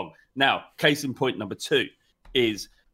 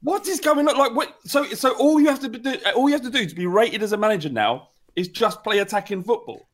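incredulous rant; 8 semitones higher than usual. Real, not acted. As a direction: demand an explanation in disbelief. Outraged at pundit's award.

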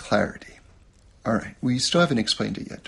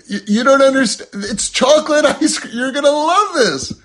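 much higher and louder, pleading and urging; frustrated coaxing; 12 semitones higher, 8 dB louder than usual. Retold or imitated. Frustrated parent coaxing a baby to eat, high pleading. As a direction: coax a stubborn baby, exasperated. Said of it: "I'm like".

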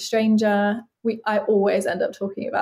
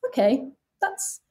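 dawning acceptance; 5 semitones higher than usual. Retold or imitated. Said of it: "that you go".

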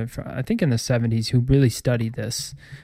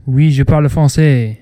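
louder, showy French accent; 7 dB louder than usual. Shown as a demonstration. Host shows off college French, French accent. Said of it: "You have a French accent?".

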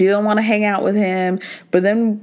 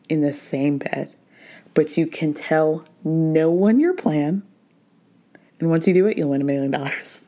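low, hushed secret-agent briefing; secret mission voice; 5 semitones lower than usual. Retold or imitated. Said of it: "They were like".

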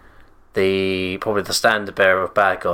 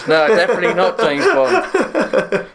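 laughing; 11 semitones higher, 4 dB louder, slower than usual. Real, not acted. Laughing at the idea.

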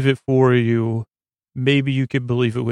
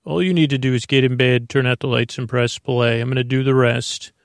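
podcast intro read. Acted. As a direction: welcome the sleepless listener gently.

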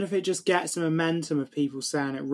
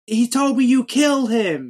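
high, loud, slow accusation; acted anguished protest; Luke Skywalker; 7 semitones higher, 6 dB louder, slower than usual. Podcaster as Luke accusing Vader, raised anguished voice. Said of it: "because Luke says".

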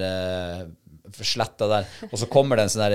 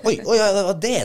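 high, loud voicing of a dog catching on; dog's sudden realisation; 12 semitones higher, 7 dB louder than usual. Hunter voicing his dog finally understanding the command, sudden mock realisation. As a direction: act out a startled "oh, that's it". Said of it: "før han liksom plutselig så bare".

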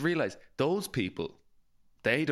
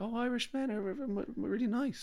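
higher, softer mock swoon; pleasantly surprised ladies; 11 semitones higher, 5 dB quieter than usual. Retelling how Spanish ladies praised Irish men, high and soft. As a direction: coo it like a charmed tourist. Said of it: "They were like".

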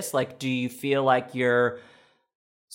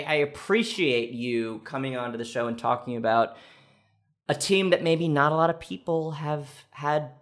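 ordinary delivery

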